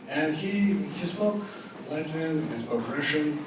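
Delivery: normal talking voice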